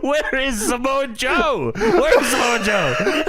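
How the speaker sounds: high, drawn-out mock holler through laughter; mock calling out. Host joining the riff on missing wrestlers, mock calling out. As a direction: holler slowly for someone missing, cracking up.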